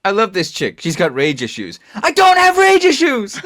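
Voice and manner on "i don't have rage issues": high, loud and slow, insisting; mock furious denial. Quoting Harley, a loud mock-furious denial. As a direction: bellow the denial, clearly overdoing it.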